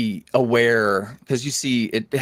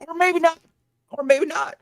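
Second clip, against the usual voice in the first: playful high aside. Real, not acted. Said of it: "self-correcting aside".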